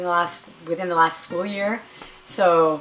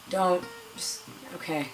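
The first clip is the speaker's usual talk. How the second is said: softer, giving up mid-sentence; resigned doctor; 7 dB quieter than usual. Retold or imitated. Doctor gives up on the fidgeting patient, resigned and soft. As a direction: let it trail off in defeat.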